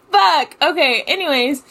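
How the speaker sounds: loud, high flustered curse; flustered outburst. Real, not acted. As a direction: shake off the embarrassment. Flustered after laughing.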